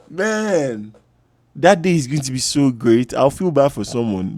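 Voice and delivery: ordinary talk, nothing marked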